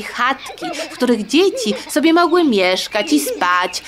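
ordinary delivery, nothing marked

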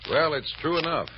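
usual speaking voice

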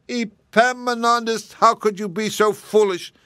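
high, loud wail of dismay, dragging his name out; a mother at the end of her rope; mammy wails at Epaminondas over the ruined bread, high and loud; despairing cry. Acted. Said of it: "cried his mammy".

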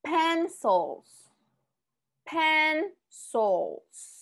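Pencils with a clear hissed final s; model pronunciation. Shown as a demonstration. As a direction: hold the s at the end of Pencils. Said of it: "Enfóquense mucho en la S". (pronuncia Pencils alargando la s final)

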